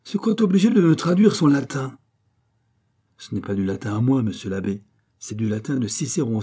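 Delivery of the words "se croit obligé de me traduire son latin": loud, high-pitched outrage; angry, raised exclamation; furious. A narrator's reading. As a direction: burst out indignantly at the cheek of it. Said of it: "s'écria l'abbé furieux".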